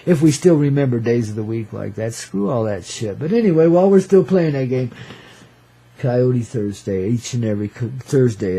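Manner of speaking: ordinary delivery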